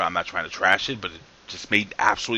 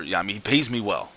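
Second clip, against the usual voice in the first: usual speaking voice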